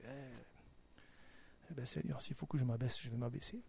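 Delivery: softened, yielding prayer; quietly surrendering after the argument; humble submission. Acted. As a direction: give in humbly to God. Acted humble answer to God.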